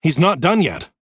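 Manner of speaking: urgent; tense warning. A narrator's reading. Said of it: "his voice tense".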